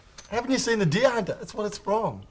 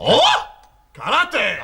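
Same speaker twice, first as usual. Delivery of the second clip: mock karate cry; 3 semitones higher, 7 dB louder, much slower than usual. Mates butting in on the ad story, mock karate cries. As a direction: shout like a hammed-up martial artist.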